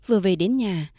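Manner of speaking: plain reading voice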